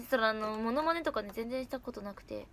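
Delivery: ordinary talk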